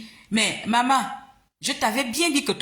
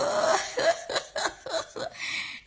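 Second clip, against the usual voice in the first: high cackling laugh; 5 dB quieter than usual. Real, not acted. Laughing at own quoted line.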